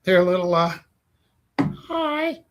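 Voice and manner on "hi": high puppet voice. Acted. Puppet greets in its voice.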